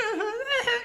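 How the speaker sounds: high-pitched whimpering stammer; mock near-crying whimper. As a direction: imitate a kid about to cry. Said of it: "the kid was about to cry".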